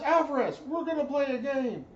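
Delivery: barked shout, way up high and loud; high, loud drill instructor shout; drill instructor yell. Retold or imitated.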